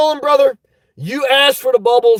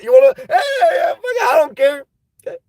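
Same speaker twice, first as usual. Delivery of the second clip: higher, half-shouted don't-care outburst; mock reckless abandon; 4 semitones higher than usual. Indulgent parent giving up on all rules, mock reckless. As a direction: throw your hands up and yell that you don't care.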